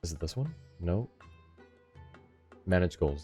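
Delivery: ordinary talk, nothing marked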